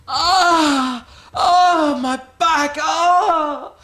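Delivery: very high, very loud wailing cries of pain over a hurt back; mock a wrestler howling like he's giving birth; hammed-up pain screams. Mocking the injured wrestler's fake agony, overblown screams. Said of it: "like he's having a baby".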